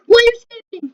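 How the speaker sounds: loud, high indignant yell; shout. Real, not acted. Player yelling at a fleeing character, loud demand. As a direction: yell the question at the runaway.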